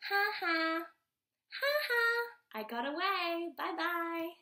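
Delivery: high, triumphant "ha ha" taunt; gloating frog. A narrator's reading. Frog who got away taunts the animals, gleeful and high. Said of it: "The frog says, Ha ha".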